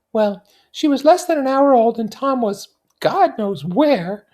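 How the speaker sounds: put-on high, light young society woman; Daisy's voice. Daisy confiding in her cousin Nick, high and light. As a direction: lift into a bright, high feminine voice.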